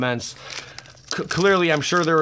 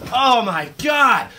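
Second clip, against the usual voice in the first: high, drawn-out amazed cry; stunned excitement; 9 semitones higher, 6 dB louder, slower than usual. Real, not acted. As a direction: let the shock stretch out slowly. Still reeling from the pull.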